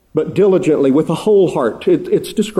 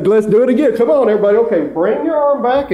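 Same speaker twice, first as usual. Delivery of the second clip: rousing song leader; 6 semitones higher, faster than usual. Retold or imitated. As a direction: pump up the room like a singing teacher. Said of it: "he'd say".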